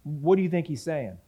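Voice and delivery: ordinary delivery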